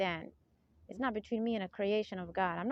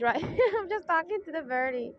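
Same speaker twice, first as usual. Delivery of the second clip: higher, laughing it out; 8 semitones higher, 5 dB louder than usual. Real, not acted. Telling viewers she talks to the birdie, laughing. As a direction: giggle through the words. Laughing about talking to birds.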